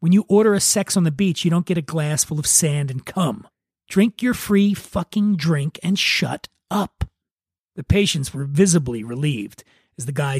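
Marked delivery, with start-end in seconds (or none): none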